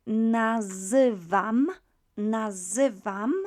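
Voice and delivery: "nazywam" loud and slow, zy as "zuh"; drawn-out ZY sound. Shown as a demonstration. Teacher drilling Z and Y together, slow, emphatic "nazywam". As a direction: sound out "nazywam" syllable by syllable, leaning on zy. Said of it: "memorize the Z and Y together as z".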